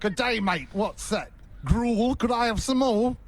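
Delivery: high-pitched Aussie orphan parody; Oliver Twist. Pretend. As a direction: plead in a mashed-up Australian-English accent. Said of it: "I think that's Oliver Twist".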